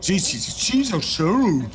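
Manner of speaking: slow, heavier American accent imitating the waiter; thick American accent. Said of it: "gros accent américain".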